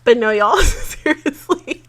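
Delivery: slow high laughing plea; laughing through words. Real, not acted. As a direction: say it while giggling. Laughing emotional outro.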